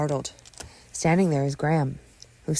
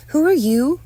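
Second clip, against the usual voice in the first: high, loud challenge; loud, confrontational question; aggressive; 12 semitones higher, 10 dB louder than usual. Acted. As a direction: demand it sharply, pitched way up. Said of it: "Aggressively, she asks".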